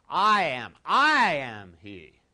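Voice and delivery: loud, slow, drawn-out declaration; emphatic. Shown as a demonstration. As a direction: declare it with full emphatic weight. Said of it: "Really emphatic".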